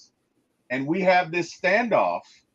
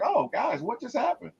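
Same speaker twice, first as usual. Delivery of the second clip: higher, softer clueless voice; dazed Robert; 9 semitones higher, 6 dB quieter than usual. Retold or imitated. Robert coming to and asking the team, dazed. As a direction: play it innocently confused. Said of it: "in true Robert Reynolds fashion".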